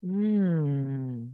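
ordinary talk

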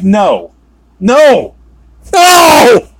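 repeated refusal climbing from firm to high scream; building to a scream. Retold or imitated. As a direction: escalate each word, ending in a loud high yell. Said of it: "Sorry, I had to scream that".

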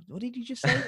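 high, mock-puzzled question; baffled. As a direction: imitate a listener who missed it. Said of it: "I haven't understood".

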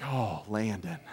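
relief. Retold or imitated. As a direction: let the fear drain out, gently. Finding the lost son.

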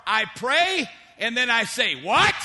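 mock-incredulous, exploding on WHAT; balking disbelief. Preacher mimics believers balking at God's orders, mock disbelief. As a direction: pray along evenly, then burst out with a loud WHAT.

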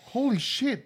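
high, startled curse; shocked exclamation. Real, not acted. Friend recoils from the whiskey smell, high and startled. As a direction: blurt it out as the fumes hit.

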